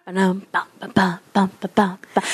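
low rhythmic bums; mouthed rock riff. Acted. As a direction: thump out the riff.

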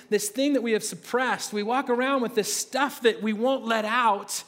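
normal talking voice

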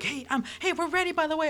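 chirpy high mock diner calling out; lightly mocking high guest voice; high customer voice. Voicing customers flagging him down.

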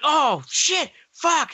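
high-pitched frustrated swearing; retold cursing. Storyteller as Derek fumbling the rocks, mock frustrated cursing. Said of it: "he's cursing".

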